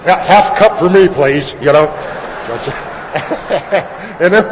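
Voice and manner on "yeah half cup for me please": loud, over-the-top jittery greetings; imitated early-sobriety shaker. Newly sober shaker greeting people at the meeting, loud and hammed-up. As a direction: blurt it out loud, like a man with the shakes. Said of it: "I was a shaker, man. I just".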